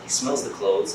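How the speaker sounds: normal talking voice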